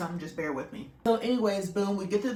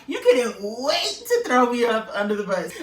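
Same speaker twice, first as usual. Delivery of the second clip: loud, raised protest with laughter in it; mock indignation; 4 semitones higher, 7 dB louder than usual. Wife calling out husband for blaming her, mock indignation. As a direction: retell it as a laughing accusation.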